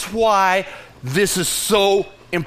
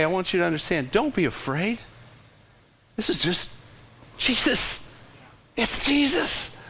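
soft reassurance; 6 dB quieter than usual. Real, not acted. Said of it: "pastor comforting church".

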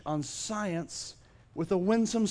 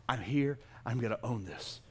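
quiet resolve; 6 semitones lower, 7 dB quieter than usual. Acted. Models a humble declaration without arrogance.

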